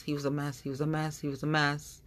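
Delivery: ordinary talk, nothing marked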